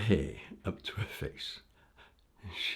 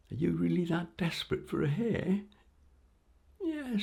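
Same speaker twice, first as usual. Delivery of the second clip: lightly voiced frail old woman, higher; old lady voice; 11 semitones higher than usual. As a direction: plead like a poor old woman. Queen disguised as old woman.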